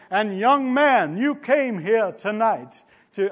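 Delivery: a half-tried Billy Graham, slowed down; Billy Graham impression. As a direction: begin a drawn-out evangelist's altar call, then give it up. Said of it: "I won't even try to do the accent".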